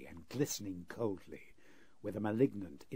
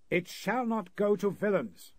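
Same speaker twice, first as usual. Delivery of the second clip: much higher, louder, slow defiant cry; indignant refusal; 10 semitones higher, 8 dB louder, slower than usual. Acted. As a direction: refuse with outraged pride. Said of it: "the same indignant reply".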